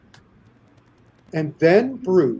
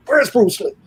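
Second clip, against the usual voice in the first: demanding Sammo; 7 semitones higher than usual. Retold or imitated. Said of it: "And he's going".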